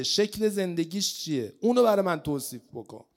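usual speaking voice

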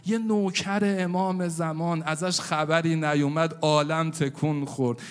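loud, fervent elegy calling out; preaching at full force. Acted. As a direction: lament and cry out to the hidden Imam.

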